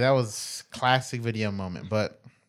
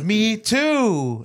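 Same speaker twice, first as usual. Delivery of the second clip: eager me too; 9 semitones higher, 5 dB louder than usual. Retold or imitated. Mimicking stranger's eager reply.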